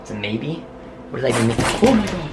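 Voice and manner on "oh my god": shocked. Real, not acted. Board moves on its own.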